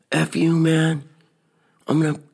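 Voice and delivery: hammed-up high holler; mock yelling. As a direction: imitate an angry young guy calling from a car. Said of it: "He's yelling things out his window".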